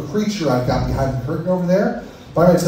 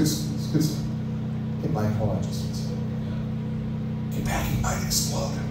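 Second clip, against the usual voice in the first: soft, unvoiced, slowed worried whisper; nervous whisper; 6 semitones lower, 9 dB quieter, slower than usual. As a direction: hush it like he might blow. Mock nervous aside to audience.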